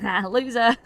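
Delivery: mock childish sneer; playground taunt. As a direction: gloat as you pass. Kid taunts as he passes.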